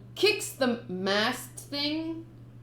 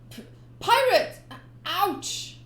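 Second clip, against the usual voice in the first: high, loud startled yelp; startled boy; 9 semitones higher, 6 dB louder, slower than usual. Acted. Boy waking to the pirate, high startled yelp. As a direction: jump at the sight of him.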